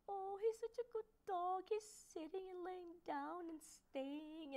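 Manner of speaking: high, slow cooing over a cute dog; gushing strangers. Retold or imitated. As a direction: coo at the dog like a smitten passerby. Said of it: "everybody was just like".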